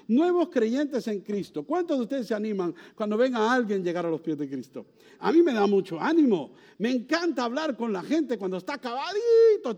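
loud, high, rousing pulpit voice; fervent preaching. Acted. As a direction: preach it to lift the congregation. Preaching at full force. (predicando con fervor, fuerte y alto)

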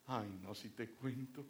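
sheepish wife owning up. Retold or imitated. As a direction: confess it like a guilty shopper. Wife confesses her shopping.

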